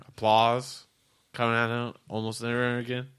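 ordinary delivery

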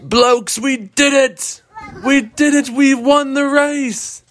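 put-on Australian accent. Lars greeting his friends after winning, mock Australian accent. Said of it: "I thought I had the accent".

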